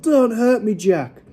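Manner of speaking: high pleading cry; yell. A narrator's reading. Giant pleads with Jack the killer, yelling. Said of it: "he yelled".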